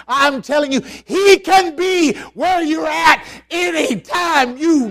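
loud, high shouted preaching; preaching at full force. Acted. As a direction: thunder it out to the congregation. Proclaims God's words to congregation.

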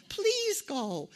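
put-on high child's voice begging; pleading child. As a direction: whine it like a small kid. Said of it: "they sucked the four-year-old on me".

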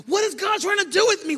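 high, loud exasperated cry; mock frustrated believer. Pastor voicing a frustrated believer, high loud cry. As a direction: shout it like a confused believer.

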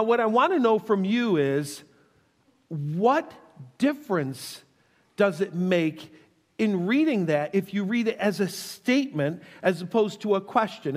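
normal talking voice

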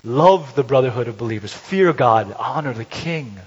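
loud, slow proclamation of verses; preacher reading scripture, loud; forceful scripture reading. Acted. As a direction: declaim the passage to the congregation.

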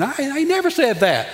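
high-pitched acted protest at being misquoted; indignant protest. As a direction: protest in disbelief, pitch jumping up. Acts out someone misquoted protesting.

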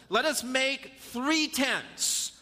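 slow, drawn-out eager proposal; eager slow Peter. Acted. Peter eagerly proposes tents to Jesus, slow and drawn out. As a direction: spell out each tent slowly like an excited disciple.

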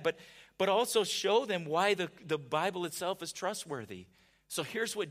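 loud, rising preacher's build; preaching at full force. Acted. Preacher thundering Jesus' answer to Martha, loud and urgent. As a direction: drive Jesus' words home to the crowd.

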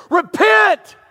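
loud, high street-preacher cry; one-word shouted warning; shouted call. Retold or imitated. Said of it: "here I would see that Marine standing there saying".

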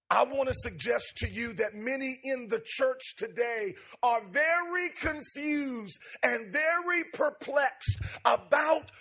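loud preaching with rising cadence. Real, not acted. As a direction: preach it to the congregation. Preaching builds after the story.

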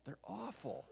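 high slow whisper; whispered complaint. Acted. Preacher confiding to the congregation about the beds, whispered.

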